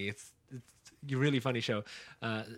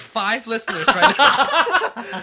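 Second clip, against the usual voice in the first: excited; 10 semitones higher, 10 dB louder than usual. Real, not acted. Excited at five listeners.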